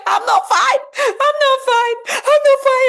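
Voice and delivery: loud, high-pitched acted panic; panicking, anxious. Shown as a demonstration. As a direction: shout it frantically, pitch shooting up. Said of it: "I'm panicking, I'm anxious".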